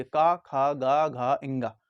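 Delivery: क ख ग घ इंगा drawn out slowly and low; very slow, low, relaxed recital; slowly and calmly. Shown as a demonstration. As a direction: take each sound slowly, mouth open. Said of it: "आराम से उच्चारण कीजिए".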